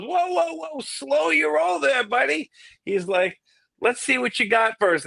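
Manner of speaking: teasing big-shot voice; condescending bandleader. Retold or imitated. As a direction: halt the eager newcomer. David stops him.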